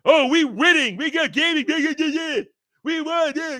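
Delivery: high-pitched silly victory crowing; mock grandiose boasting. Mocking grifters declaring they have won, exaggerated gloating. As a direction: babble triumph like a gloating fool. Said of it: "they make these grandiose statements".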